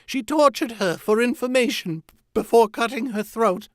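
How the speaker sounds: high, loud, frantic confession; anguished cry. A narrator's reading. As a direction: keep crying it out to the end. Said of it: "cried Wojin".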